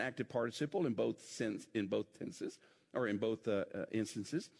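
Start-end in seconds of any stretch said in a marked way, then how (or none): none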